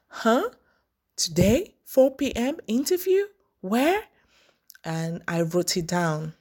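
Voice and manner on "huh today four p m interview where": high, rising incredulous questions; puzzled, pitched-up short questions; taken aback. Retold or imitated. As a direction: echo the news in startled disbelief. Retelling surprise at sudden interview.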